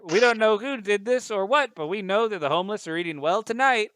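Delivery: high, loud newsman delivery; reporter voice. Acted. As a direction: spin it like a cheerful newsman. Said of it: "And they're like".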